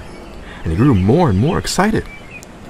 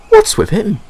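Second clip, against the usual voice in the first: much higher, louder puzzled question; puzzled Dexter; 13 semitones higher, 5 dB louder than usual. A narrator's reading. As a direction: wonder aloud about Thomas. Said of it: "he wondered".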